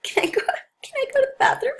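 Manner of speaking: bizarre patient voice. Retold or imitated. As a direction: pitch it way up, oddly childlike. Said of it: "and they're like".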